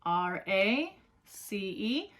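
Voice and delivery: slowly spelled letter by letter; spelling out letters. Shown as a demonstration. Teacher spelling race for students. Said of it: "Let's check it together".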